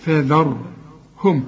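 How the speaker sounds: فذرهم slow, light dhal, heavy ra; light dhal recitation. Shown as a demonstration. Teacher correcting the dhal in فذرهم. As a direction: keep the ذ light, not ظ. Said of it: "الراء هي المفخمة".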